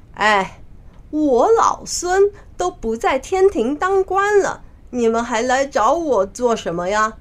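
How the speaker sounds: indignant call, high in the middle; loud protest. Acted. Monkey King confronts the heavenly envoys, loudly indignant. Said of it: "他大声地说".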